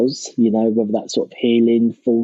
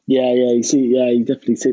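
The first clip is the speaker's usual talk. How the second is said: usual speaking voice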